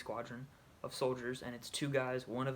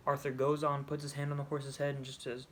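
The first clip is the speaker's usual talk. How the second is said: ordinary talk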